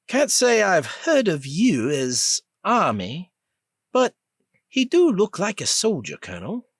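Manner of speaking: the younger prisoner telling the colonel about Rip; younger man's voice. Acted. Younger man voiced in story.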